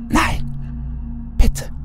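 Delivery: usual speaking voice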